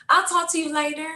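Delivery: put-on a carefree girlish "I'll talk to you later"; high casual goodbye. Daughter hanging up with her dad, high and breezy.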